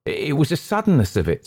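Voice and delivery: high, sheepish excuse; lightly voiced sheepish waiter. A narrator's reading. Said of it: "said George sheepishly".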